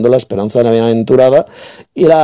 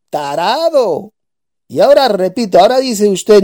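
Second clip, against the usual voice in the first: raised, higher-pitched tirade; angry rant; 7 semitones higher than usual. Real, not acted. Debater berating his opponent as a fool, angry and raised. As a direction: lash out at the opponent.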